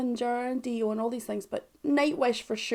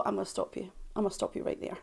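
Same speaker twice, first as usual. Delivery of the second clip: quiet stop; 2 semitones lower, 9 dB quieter, faster than usual. Acted. Cutting off commenters' argument.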